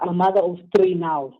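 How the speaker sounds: usual speaking voice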